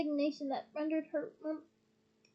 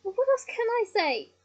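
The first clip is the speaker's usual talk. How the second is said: raised, louder retort; exasperated shout; 7 semitones higher, 7 dB louder than usual. A narrator's reading. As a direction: shout back in your own defence. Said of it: "Ron shouted".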